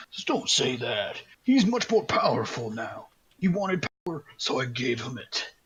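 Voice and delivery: a hint of a gloating villain, pitch swinging; lightly voiced smug villain. Bogthrough tells the paladin about the power he gave, lightly voiced villain. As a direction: lightly play the villain defending his gift. Game master plays Bogthrough.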